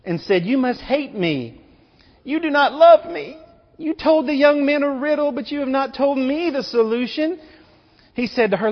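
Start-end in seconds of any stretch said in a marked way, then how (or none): 0.5-7.4 high, whiny imitation of a weeping bride; lightly hammed-up crying complaint, pitched high; playing the bride in mock tears, half-sung whine; mock tearful pleading. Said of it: "Samson's bride cried on his shoulder".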